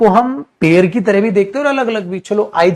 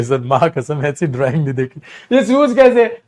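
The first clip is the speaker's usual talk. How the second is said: mock student; 5 semitones lower than usual. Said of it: "आप कहोगे".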